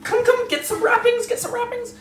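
very high urgent falsetto; squeaky gnome hurrying someone; gnome priestess voice. Acted. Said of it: "and says".

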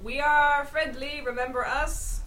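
a hint of a kindly welcome. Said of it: "in a kindly manner, as if to say".

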